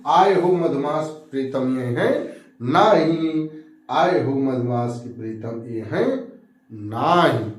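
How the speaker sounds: slow recital, dropping low; low, measured poem reading; verse recitation. Acted. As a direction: draw out the last word of each line.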